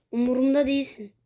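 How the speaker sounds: usual speaking voice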